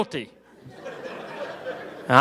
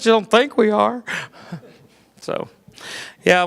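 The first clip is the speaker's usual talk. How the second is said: ordinary delivery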